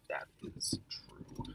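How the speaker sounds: slow breathy whisper. Real, not acted. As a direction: whisper it, drawn right out. Whispered agreement.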